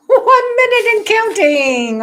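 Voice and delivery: squealing countdown; excited. Real, not acted. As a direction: announce the countdown with glee. Laughing countdown announcement.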